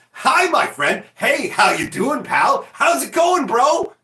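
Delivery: high, loud, fake-friendly hellos; mock buddy-buddy shouting at the neighbour; taunting greeting. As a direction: greet him just to annoy him. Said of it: "every fucking time I saw him".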